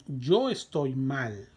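stretched out for learners; slow model reading. Shown as a demonstration. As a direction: say it slowly and clearly. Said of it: "teacher models the answer".

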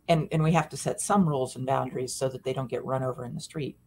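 ordinary talk, nothing marked

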